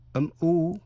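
ordinary delivery

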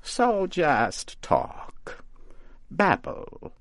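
mocking witch. A narrator's reading. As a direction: teach him with sly mockery. Witch lecturing scarecrow.

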